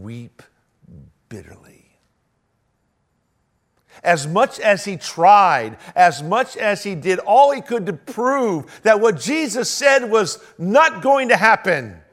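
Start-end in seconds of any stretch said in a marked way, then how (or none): 4.0-12.0 loud, high, slow emphatic climax; full-force preaching. Acted. Pastor preaches Peter's failure with full force. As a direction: drive the point home at full force.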